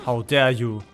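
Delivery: usual speaking voice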